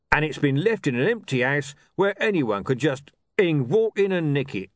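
a thug gushing over art; rough thug voice. A narrator's reading.

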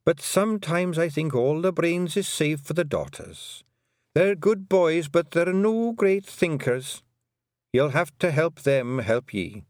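put-on fond old Scots mother, slowing on the last lines; a touch of weary fondness for her sons; old Scottish Kelda. A narrator's reading. Kelda's advice.

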